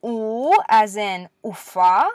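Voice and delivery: "U" as a pure oo with no Y glide, as in "ufa", said slowly; slow teacher demo of hard "ufa". Shown as a demonstration. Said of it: "here's the hard version".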